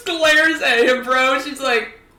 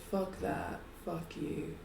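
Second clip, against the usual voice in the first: low, very soft curse; muted defiance; 8 semitones lower, 22 dB quieter than usual. Acted. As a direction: curse defiantly under the breath. Character answers the villain's threat.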